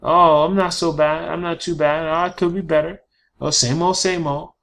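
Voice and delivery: lightly mocked, chirpy stock answers; stock replies. Coach mocking how people answer how are you, chirpy. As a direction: rattle off the lazy small-talk replies. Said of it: "you can't just say things like".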